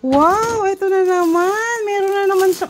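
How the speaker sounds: high, loud and excited. Real, not acted. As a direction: gush over the gift. Parent excited over gift.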